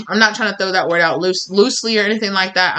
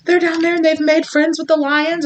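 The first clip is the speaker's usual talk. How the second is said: high-pitched messenger breaking awkward news; mock apology; 8 semitones higher than usual. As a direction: act out apologetically reporting a surprise, pitched up. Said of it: "people are like".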